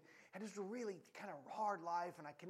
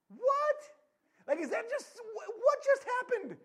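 high, loud burst of disbelief; high-pitched, hammed-up astonishment; incredulous; 11 dB louder, slower than usual. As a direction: blurt it out, utterly baffled. Mock disbelief at scripture's sudden turn.

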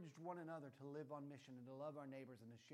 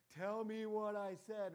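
slow, much louder and higher demand; overbearing parent barking; jerk dad; 5 semitones higher, 10 dB louder, much slower than usual. Pretend. Dad mock-demanding his daughter, hammed-up bossy. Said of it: "I'm not that big of a jerk".

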